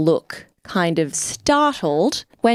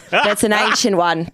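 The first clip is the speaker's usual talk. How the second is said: laughing; 3 semitones higher than usual. Real, not acted. Laughing at coexist joke.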